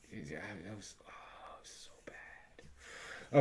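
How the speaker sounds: drawn-out whisper of disgust; slow despairing whisper. Real, not acted. Reviewer groaning about a bad comic, slow whisper. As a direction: breathe it out slowly, appalled.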